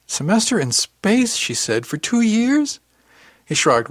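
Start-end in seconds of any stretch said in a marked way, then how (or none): 0.1-1.4 high, loud, drawn-out disbelief; incredulous echo. A narrator's reading. Aunt repeating nephew's excuse back, incredulous. 1.9-2.8 very high, loud question; incredulous. A narrator's reading. Aunt questioning nephew's two years away, incredulous.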